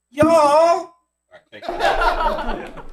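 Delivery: high, loud, drawn-out y'all; touched Texan woman. Pretend. Woman from Texas finding her surprise party, touched. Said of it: "a woman from Texas who thought her friends forgot".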